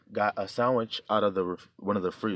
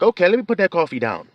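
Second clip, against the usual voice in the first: flustered; 9 semitones higher, 8 dB louder than usual. Retold or imitated. Retold inner thought.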